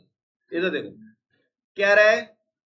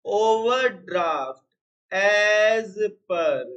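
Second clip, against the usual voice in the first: slow dictation; much slower than usual. Shown as a demonstration. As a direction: stretch overdraft out word by word. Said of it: "sorry, overdraft". (धीरे-धीरे लिखवाते हुए)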